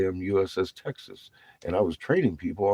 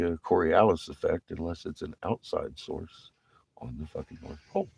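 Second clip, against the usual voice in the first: usual speaking voice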